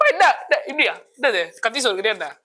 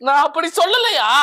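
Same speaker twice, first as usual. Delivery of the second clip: indignant disbelief; 7 semitones higher than usual. Acted. Mock indignant denial to interviewer.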